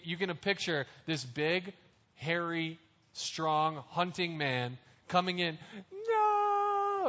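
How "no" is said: high, loud crying no; anguished wail. Acted. Big hunter Esau wails to his father, high and loud. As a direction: wail it like a man who lost everything. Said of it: "And he's crying and he's screaming".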